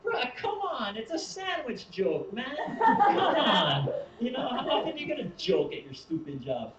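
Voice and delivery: high, loud, laughing protest; laughing plea. Retold or imitated. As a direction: beg him to see the joke. Pleading with the angry worker.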